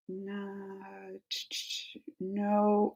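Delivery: unvoiced whisper of realization; hushed no. Real, not acted. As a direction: breathe it out softly. Realizing the answer.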